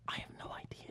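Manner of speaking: very soft, voiceless aside; whisper. Real, not acted. Answering friends how the speech came about, whispered. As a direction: whisper it almost to yourself.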